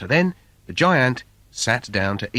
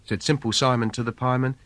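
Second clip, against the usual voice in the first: plain reading voice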